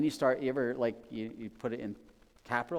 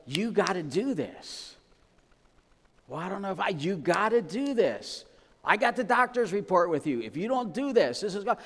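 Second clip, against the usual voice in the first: firm urging; 7 semitones higher, 6 dB louder than usual. Retold or imitated. Urging someone with a doctor's report to act, forceful. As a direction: lay down the law like a worried friend. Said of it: "you're like".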